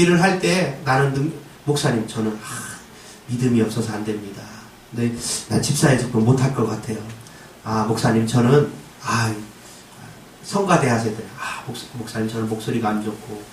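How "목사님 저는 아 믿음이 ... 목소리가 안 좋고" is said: lower, softer hesitant excuses; whiny excuse-maker. Retold or imitated. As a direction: mumble the excuses reluctantly. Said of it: "이러지 마시고".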